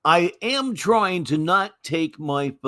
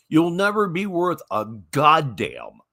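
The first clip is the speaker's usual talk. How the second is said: ordinary delivery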